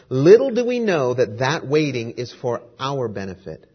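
ordinary talk, nothing marked

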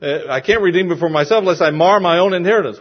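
acted anxious objection; worried. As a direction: blurt it out fast, as if fretting over your own loss. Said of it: "He's worried. He says".

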